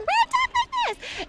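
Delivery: very high, squeaky character voice; mocking a cute anime voice on Toonami. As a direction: squeak like a cutesy anime girl. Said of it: "Night of Monica going".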